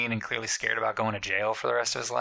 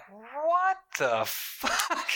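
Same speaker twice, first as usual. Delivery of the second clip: drawn-out, high, squeaky what the fuck; hammed-up incredulous squeal; stunned disbelief; much slower than usual. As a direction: stretch it out high in mock shock. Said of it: "And you're like".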